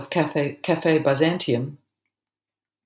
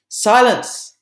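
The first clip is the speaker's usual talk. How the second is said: loud, higher cry of silence; sudden shout; 6 semitones higher, 6 dB louder than usual. Acted. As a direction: burst out with the command. Sudden outburst in the poem.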